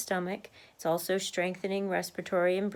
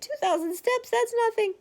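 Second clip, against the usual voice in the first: high, incredulous scoff; mock a viewer who thinks it's nothing; 4 dB louder than usual. Mimicking viewers who scoff at her step count, high and incredulous. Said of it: "you're like".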